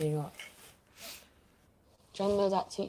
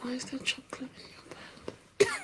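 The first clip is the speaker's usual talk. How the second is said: whispering; 6 semitones higher, faster than usual. Acted. Hushed teasing question.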